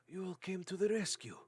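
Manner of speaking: high-pitched, marveling voice; delighted dwarf. Acted. Dwarf to his rescuers, delighted marveling. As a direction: marvel at them with swooping pitch.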